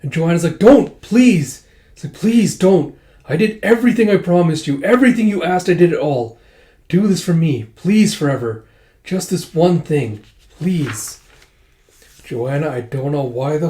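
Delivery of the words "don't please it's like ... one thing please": high, louder, begging mother; mother begging her daughter to spare the father; desperate pleading. Retold or imitated. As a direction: plead frantically to stop her. Mother begging daughter not to kill.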